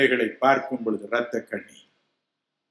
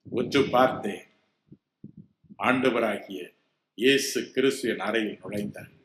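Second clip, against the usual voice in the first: ordinary talk, nothing marked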